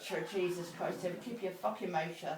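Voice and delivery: low, soft gruff dad warning; a hushed, low fatherly warning; mock hushed warning. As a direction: drop low and quiet like dad. Dad's retold warning.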